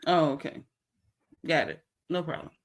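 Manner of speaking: soft, wary aside about a noise; hushed, checking for an intruder. Real, not acted. Vlogger hushed, listening for a noise at home. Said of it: "aside checking a noise".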